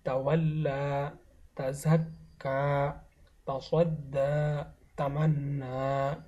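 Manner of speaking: slow "তাযাক্কা, তাসাদ্দা, তামান্না", doubled consonant then long final aa; silent ya. Shown as a demonstration. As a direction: draw out the last aa of "তাওয়াল্লা" and each word after, no y. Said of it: "একইভাবে". ("তামান্না"-র মতো শেষে ইয়া ছাড়া লম্বা টানা আ)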